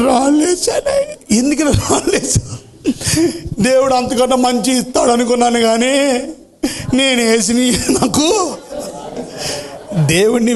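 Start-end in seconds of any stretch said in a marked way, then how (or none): 0.1-8.6 put-on high, slow whining child; tearful boy. A narrator's reading. Boy explains to pastor, high and whining. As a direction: whimper out the excuse.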